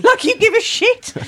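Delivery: high, laughing through the words. Real, not acted. Mocking her friend's cinema rant, laughing high. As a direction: let the laugh take over.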